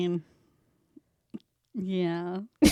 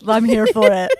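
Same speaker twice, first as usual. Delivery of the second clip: normal talking voice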